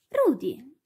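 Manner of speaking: put-on narrated boy's startled call; surprised shout. A narrator's reading. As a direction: call out the dog's name in surprise. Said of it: "gridò Alfie tra una leccata".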